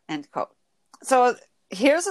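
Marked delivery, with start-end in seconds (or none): none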